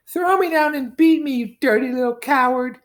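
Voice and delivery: high, very loud enraged shriek; furious cry. Acted. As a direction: hurl the insult at him, screaming. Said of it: "he heard her cry".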